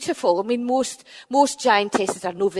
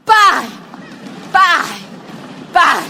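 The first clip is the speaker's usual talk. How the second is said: loud shouted bangs; 6 semitones higher, 9 dB louder, much slower than usual. Acted. Benandonner pounding the door, loud bangs. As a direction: shout each bang like a fist on wood.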